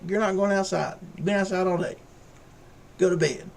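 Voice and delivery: high-pitched, exasperated refusal; mock scolding. Owner refusing his barking dog at night, high exasperated scolding. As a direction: tell off a nagging dog in a high voice.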